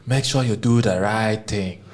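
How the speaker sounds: put-on slow, firm grandfather in an African accent; grandfather's African accent, slow and commanding. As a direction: lean into the African accent, measured and stern. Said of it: "in his African accent".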